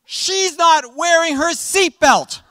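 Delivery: put-on slow, high, loud childish outrage; excited son. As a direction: kid insisting dad look, then tattling. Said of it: "the son goes".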